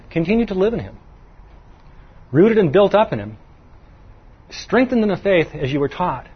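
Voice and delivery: normal talking voice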